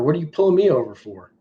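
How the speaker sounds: normal talking voice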